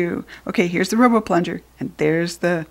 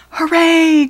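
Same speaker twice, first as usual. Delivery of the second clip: high, loud cheer; cheering hooray; 8 semitones higher, 5 dB louder than usual. A narrator's reading. George cheers as the robot appears. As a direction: burst out in triumph. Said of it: "cried George".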